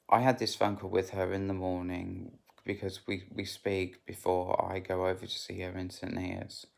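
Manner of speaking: ordinary delivery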